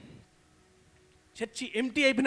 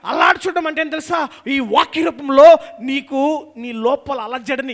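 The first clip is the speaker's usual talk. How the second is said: preaching at full force; 3 semitones higher, 13 dB louder than usual. Acted. Pastor shouting the question to his church, full force preaching.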